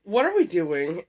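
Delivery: normal talking voice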